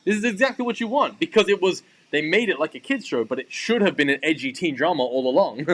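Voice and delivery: high-pitched, emphatic excitement; excited insistence. Real, not acted. Co-host insisting on a teen drama reboot, excited. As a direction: argue it eagerly, pitch lifted well up.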